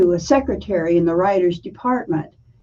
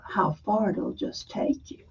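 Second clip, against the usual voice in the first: breathy hush on the last words; hushed whisper; 11 dB quieter than usual. Retold or imitated. A Southern teacher tells young Martha her future, whispered. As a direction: whisper it like a secret promise.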